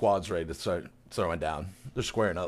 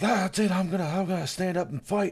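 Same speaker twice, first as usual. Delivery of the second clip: high-pitched, hammed-up defiance; rally yourself as a comic old mayor; mock fed-up mayor; 9 semitones higher than usual. Mayor declaring he will fight, mock indignant.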